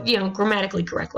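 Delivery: ordinary talk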